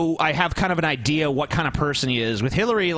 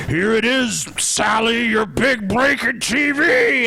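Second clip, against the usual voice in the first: slow, higher taunting villain voice; Freddy Krueger voice; 7 semitones higher, 4 dB louder, much slower than usual. Retold or imitated. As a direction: drawl it out like a gleeful killer. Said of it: "He's like".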